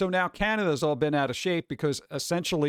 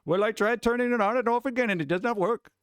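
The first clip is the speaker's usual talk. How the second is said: voice changer caller; 7 semitones higher than usual. Retold or imitated.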